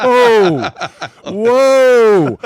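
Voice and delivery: loud high laughing whoa. Real, not acted. Teammates reacting to the kicker's celebration, laughing loud whoa.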